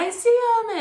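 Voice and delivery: high, loud excited cry; adults freaking out. Retold or imitated. Adults freaking out about Santa, high loud excited. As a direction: shout it like thrilled relatives. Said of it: "all the adults are, like, freaking out".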